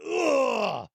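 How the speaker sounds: put-on straining to climb up off the floor, old man groan. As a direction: grunt like a creaky old man getting up. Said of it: "making all the old man noises".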